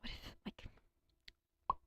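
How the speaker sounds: unvoiced trailing whisper. Real, not acted. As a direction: drop to a whisper.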